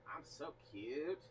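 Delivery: ordinary talk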